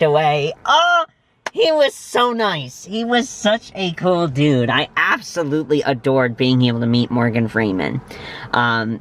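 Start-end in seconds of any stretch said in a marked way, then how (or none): 0.6-4.7 gushing. Real, not acted. Gushing about meeting Freeman.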